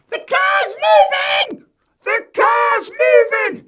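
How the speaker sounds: very high, loud, slow panicked scream; screaming child. A narrator's reading. Eddie screaming from the rolling car in panic. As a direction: scream it like a terrified toddler. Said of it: "He's screaming".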